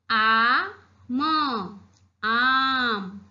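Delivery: आ and म said apart, then joined into आम; syllable blending. Shown as a demonstration. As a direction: sound out आ ... म, then say आम. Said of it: "क्या पढ़ेंगे हम इसे?". (अक्षर जोड़कर आम बोलना)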